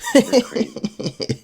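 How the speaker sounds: slow, laughing high squeal; giggling squeak of fear. Real, not acted. Laughing about MS thirteen.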